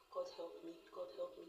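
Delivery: soft, hushed plea under the breath; dropping the bag, praying for help, very softly; desperate prayer. Retold or imitated. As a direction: murmur it to yourself in dread. Panicked prayer after dropping bag.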